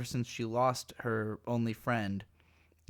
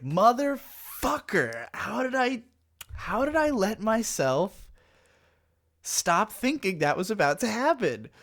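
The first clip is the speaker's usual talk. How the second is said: exasperated outburst; 10 semitones higher, 5 dB louder than usual. Retold or imitated. Viewer cursing the show for killing off the girl, high indignant yell. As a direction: yell it at the screen like the show betrayed you. Retold reaction to character's death.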